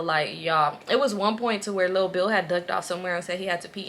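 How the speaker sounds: ordinary talk, nothing marked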